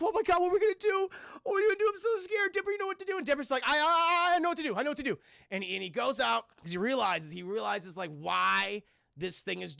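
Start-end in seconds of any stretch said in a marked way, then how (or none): 0.0-3.1 very high, rapid frightened squeal; hammed-up terrified teen, pitched way up; mock panic. Acting out Wendy scared while hiding. 3.6-5.2 high, loud stammer, then easing down; hammed-up panicked reply; mock panic. Acting out Dipper panicking in reply.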